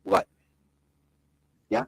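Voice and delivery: ordinary talk, nothing marked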